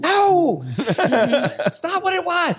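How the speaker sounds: high, laughing "no" that cracks up mid-line; laughing protest. Real, not acted. Cracking up at his own Siri joke, laughing. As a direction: laugh through the denial.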